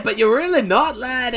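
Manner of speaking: high, loud Irish scolding; hammed-up Irish put-down; mock Irish accent. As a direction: imitate an Irish woman telling off lads. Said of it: "How do I do an Irish accent?".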